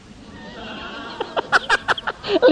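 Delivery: long high unvoiced laugh; a breathless giggle rising high. Real, not acted. Big laugh in sermon. (tawa tinggi tanpa suara)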